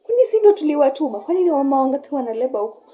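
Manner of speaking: a mocking nurse grumbling; dismissive nurse. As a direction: scoff like an unkind nurse. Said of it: "I overheard one saying ati".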